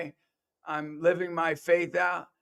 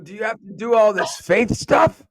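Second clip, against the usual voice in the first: exasperated parent; 5 semitones higher, 7 dB louder than usual. Retold or imitated. Parents questioning his faith.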